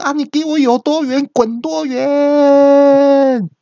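high, loud, slow furious shout; furious yell. Retold or imitated. Furious friend yelling at the narrator to get lost, loud and high. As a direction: bellow it out in mock rage. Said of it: "那个朋友就气到大叫说".

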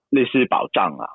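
ordinary delivery

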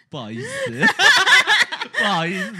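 apologizing through a big high laugh; can't stop laughing while saying sorry. Real, not acted. 笑到停不下来道歉. (边笑边道歉)

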